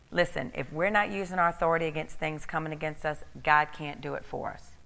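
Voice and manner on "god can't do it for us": ordinary talk, nothing marked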